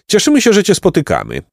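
butter up a stranger; mildly wheedling politeness; ingratiating. A narrator's reading. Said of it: "rzekł Jacek przymilnie".

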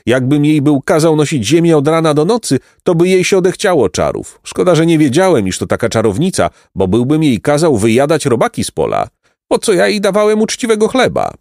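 high, animated farmer grumbling; indignant peasant. A narrator's reading. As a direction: complain as a put-out peasant, rising in pitch.

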